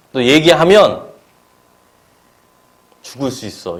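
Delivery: ordinary talk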